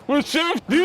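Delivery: high shouted hype. Real, not acted. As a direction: shout out the host's name. Hyping the host.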